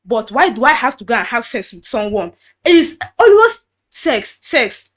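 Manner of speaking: retold pastor's angry accusation. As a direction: hammer the word sex in anger.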